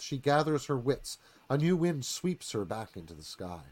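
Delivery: ordinary talk, nothing marked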